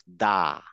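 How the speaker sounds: দা said with mouth wide open; teacher models দা, open mouth. Shown as a demonstration. Teacher models letter for class: open-mouthed দা. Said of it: "বলতেছি হচ্ছে open mouth".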